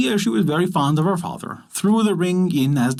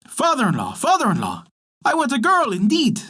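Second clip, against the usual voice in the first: lightly voiced frog, higher on the demand; 8 semitones higher than usual. A narrator's reading. Frog demands a girl from the father, lightly voiced. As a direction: insist like a spoiled frog.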